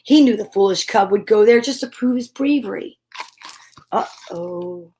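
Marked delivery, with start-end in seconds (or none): 3.9-4.9 whispered uh-oh. Acted. Reaction to evil plan.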